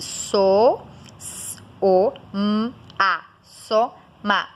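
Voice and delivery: "soma" broken into separate held sounds; s, o, m, a, each isolated. Shown as a demonstration. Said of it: "ele foi produzido".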